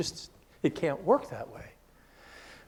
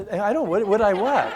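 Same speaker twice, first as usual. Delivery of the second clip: flustered surprise; 6 semitones higher, 10 dB louder than usual. Retold or imitated. Caught off guard by request.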